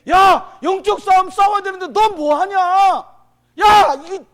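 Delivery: a furious divine yell; God shouting. Acted. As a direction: bellow it like God rebuking a lazy soldier. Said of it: "하나님이 여러분에게 말씀하시는 거예요".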